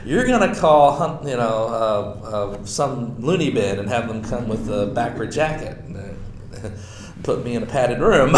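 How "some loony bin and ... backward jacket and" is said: ordinary delivery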